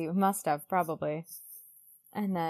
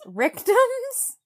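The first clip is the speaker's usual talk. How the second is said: a much higher and louder squeaked question; squeaky imitation of Dylan; 8 dB louder than usual. Host mimicking Dylan's one-word remark, squeaked high. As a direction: squeak it like a mock-incredulous friend. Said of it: "Dylan just goes".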